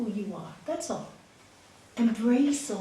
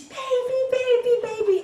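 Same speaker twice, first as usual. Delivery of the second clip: high, loud anguished calls; mother crying out; 14 semitones higher, 8 dB louder than usual. Acted. Mother calling her drowning baby, anguished shouts. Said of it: "and she's".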